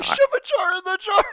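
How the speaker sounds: high, loud mocking yell; mock outrage. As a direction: shout it in mock disbelief. Mocking a flubbed line.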